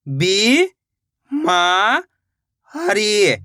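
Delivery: बी, मा, हरी, each long and separate; syllable-by-syllable sounding out. Shown as a demonstration. Teacher shows the method to copy, बी, मा, हरी one by one. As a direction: hold बी, मा and हरी apart. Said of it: "एक एक अक्षर का उच्चारण करके".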